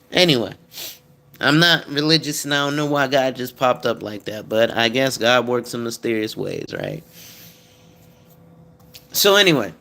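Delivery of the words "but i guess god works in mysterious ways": ordinary delivery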